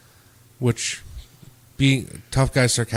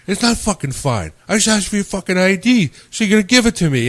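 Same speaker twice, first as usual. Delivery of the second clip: raised, louder retold anger; mock angry bouncer; 9 semitones higher, 5 dB louder than usual. Bouncer snapping at a kid who won't show ID, mock angry. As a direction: bark it at the kid like a fed-up bouncer. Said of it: "you instantly got mad at him".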